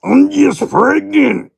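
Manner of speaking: high-pitched indignant character voice; Lady L voice. Acted. Back in character for the joke, high and loud. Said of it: "I broke character".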